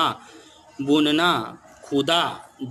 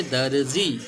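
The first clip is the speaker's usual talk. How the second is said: ordinary talk, nothing marked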